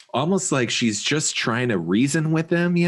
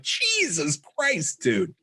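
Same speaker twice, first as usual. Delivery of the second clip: mock exasperated shock; 3 semitones higher, 3 dB quieter, slower than usual. Podcaster recalling a shock at the film, drawn-out mock disbelief.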